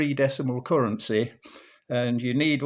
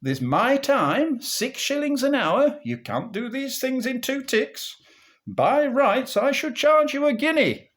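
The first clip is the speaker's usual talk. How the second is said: much higher doctor voice as he tallies his fee; doctor pricing up; 11 semitones higher than usual. Acted. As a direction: pitch him up high as the doctor piles on the charges.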